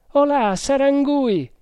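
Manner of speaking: high, loud, drawn-out call; shouted hail. A narrator's reading. Sergeant hailing the hunter Sarangui, shouted. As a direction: call out to someone at a distance, pitched high. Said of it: "gli gridò".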